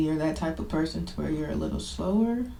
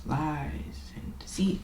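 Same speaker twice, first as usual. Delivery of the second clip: hushed whisper; 3 semitones lower, 7 dB quieter, slower than usual. Real, not acted. Confession, hushed admission.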